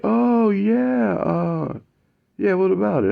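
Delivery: high, casual, what-about-it voice; offhand reply. Retold or imitated. Mike answering Matt offhandedly in a high voice.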